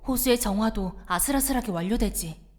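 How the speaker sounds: measured, hushed storyteller voice for a creepy tale; ghost story telling. Acted. Telling a companion a village ghost story, measured storyteller voice. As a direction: draw the listener into the grim legend.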